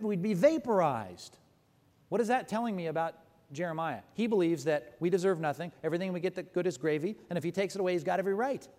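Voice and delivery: usual speaking voice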